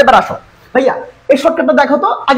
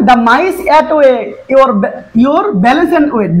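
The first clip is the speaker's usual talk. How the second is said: slow dictation; much slower than usual. Shown as a demonstration. Teacher dictating English sentence.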